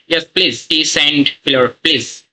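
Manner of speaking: ordinary talk, nothing marked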